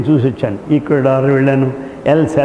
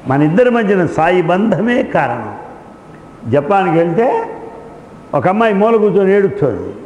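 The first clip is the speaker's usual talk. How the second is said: ordinary talk, nothing marked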